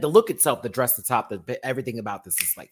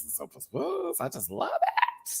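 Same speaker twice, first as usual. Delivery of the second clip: much higher, softer falsetto; falsetto gush; 3 dB quieter than usual. Real, not acted. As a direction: coo it in a swooning falsetto. Gushing over the look.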